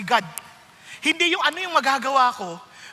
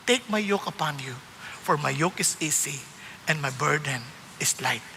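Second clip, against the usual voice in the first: gentle invitation; 10 semitones lower, 5 dB quieter than usual. Acted. Quoting Jesus' gentle invitation.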